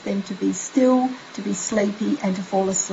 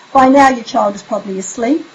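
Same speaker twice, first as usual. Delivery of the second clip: ordinary delivery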